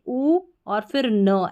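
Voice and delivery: slow "ऊ", then "न" as its own sound; separated sounds demo. Shown as a demonstration. Teacher corrects the spelling for learners, slowly separating "ऊ" from "न". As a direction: sound out "ऊ" and "न" one by one. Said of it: "ऐसे इसको correct कर ले".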